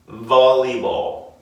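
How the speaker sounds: slow, deliberate Volleyball. for learners to copy; slow model word. Shown as a demonstration. Teacher modelling the word for students to repeat. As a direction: draw Volleyball. out clearly. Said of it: "Repeat after me".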